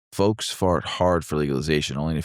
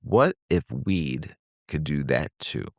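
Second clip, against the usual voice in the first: slow musing; slower than usual. Pretend.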